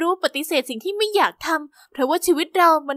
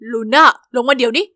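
lower, louder irritated command; irritated order; 4 semitones lower, 5 dB louder than usual. Acted. Boyfriend ordering Luna out of the car, irritated lower command. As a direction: bark the order at her. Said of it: "น้ำเสียงของเขาดูหงุดหงิด".

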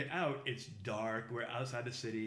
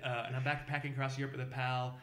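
usual speaking voice

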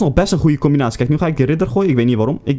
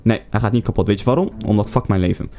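usual speaking voice

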